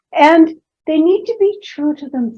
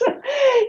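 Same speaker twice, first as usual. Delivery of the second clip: high laugh; 12 semitones higher than usual. Real, not acted. Laughing at story.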